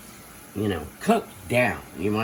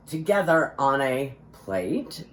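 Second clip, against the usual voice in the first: ordinary delivery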